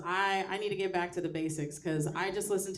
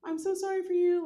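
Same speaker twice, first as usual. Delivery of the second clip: mock pity; 11 semitones higher, 6 dB louder, slower than usual. Mocking sympathetic friends.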